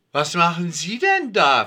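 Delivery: astonished. A narrator's reading. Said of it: "fragt sie erstaunt".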